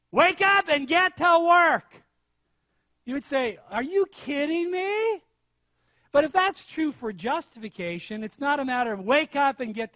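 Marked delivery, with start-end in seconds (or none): 0.1-2.0 slow, high, loud parody order; mock shouted command. Preacher acting an answer to a seeker, mock shout. 3.7-5.2 high, slow, disbelieving question; mock incredulity. Seeker answering absurd advice, acted disbelief.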